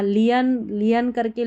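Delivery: "लियन" in two syllables, lee-yan; wrong pronunciation. Shown as a demonstration. Teacher shows what to avoid, "लियन" as lee-yan. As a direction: misread learn as "लियन". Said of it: "करके नहीं पढ़ना है".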